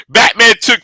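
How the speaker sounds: ordinary talk, nothing marked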